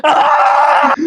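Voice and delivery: loud fake demonic yell; a sudden hammy shriek; mock scream.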